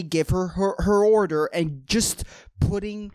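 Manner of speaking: ordinary delivery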